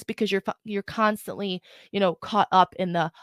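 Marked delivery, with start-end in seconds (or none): none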